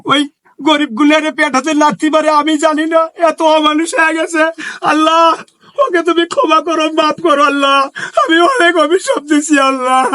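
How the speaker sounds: high wailing lament; crying. Acted. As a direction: weep with remorse while praying. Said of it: "আপনি কান্না করিয়ে না".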